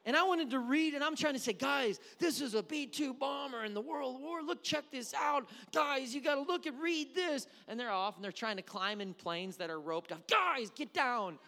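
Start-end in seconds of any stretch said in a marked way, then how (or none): 1.5-7.5 high, loud, urgent enthusiasm; eager dad. Retold or imitated. Dad showing kids a bomber at the museum, eager. 10.3-11.4 high, drawn-out loud yell; alarmed dad shout. Retold or imitated. Dad calling kids off roped-off planes, exasperated.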